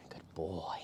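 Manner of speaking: soft whisper of praise to the dog. Real, not acted.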